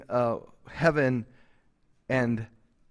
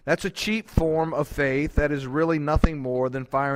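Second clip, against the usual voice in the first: louder preaching read of a quote; 5 dB louder than usual. Acted. Pastor reading a quote to his congregation, loud preaching. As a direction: quote it to the pews with full pulpit force.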